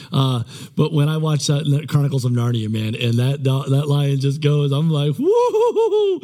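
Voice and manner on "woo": very high, long wavering "woo"; high excited whoop. Retold or imitated. Pastor reacting to a movie lion's roar, high whooping. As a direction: let out a high giddy whoop. Imitates his reaction to the lion.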